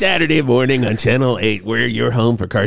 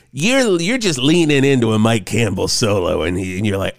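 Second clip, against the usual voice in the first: ordinary delivery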